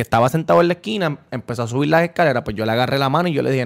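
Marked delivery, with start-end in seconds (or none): none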